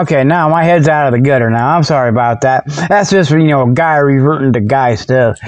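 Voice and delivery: ordinary talk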